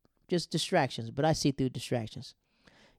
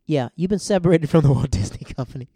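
loud mock official on the phone; loud hammed-up company announcement; company caller voice; 10 dB louder than usual. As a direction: boom it out like a corporate voice firing him. Disney firing him by phone.

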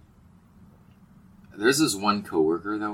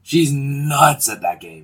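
amazed; 9 semitones higher, 9 dB louder, slower than usual. Real, not acted. Amazed at coworker's skill.

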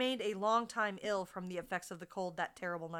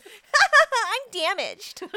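very slow, high, loud wail; dramatic damsel; 12 semitones higher, 14 dB louder, much slower than usual. Acted. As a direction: drag it out melodramatically. Mock dramatic confession.

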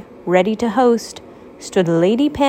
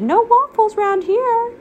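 cheerful Pancake; 13 semitones higher than usual. Acted. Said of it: "Pancake responded".

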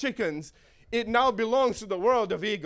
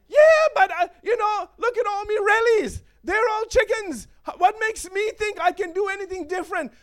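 doubting chicken; 8 semitones higher, 4 dB louder than usual. Pretend.

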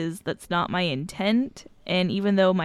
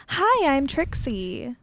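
sex line voice; 4 semitones higher, slower than usual. Retold or imitated. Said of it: "how would you change your voice".

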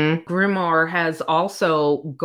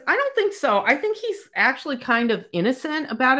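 mock retort; 8 semitones higher, faster than usual. Heroine's retort to the orc.

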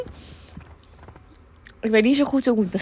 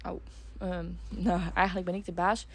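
soft muttered thought; under her breath; quiet aside; 11 dB quieter than usual. Retold or imitated.

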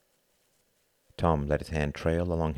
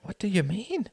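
small, high, rising voice; startled question; 3 dB quieter than usual. Acted. Hart startled by his friend's outburst, timid. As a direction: ask timidly, pitched high.

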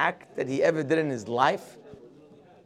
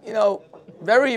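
ordinary delivery

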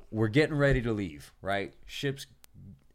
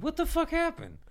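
disbelief. Retold or imitated. Quoting his own disbelief.

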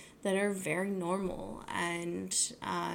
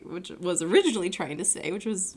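normal talking voice

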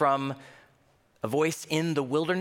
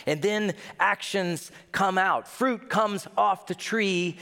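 ordinary talk

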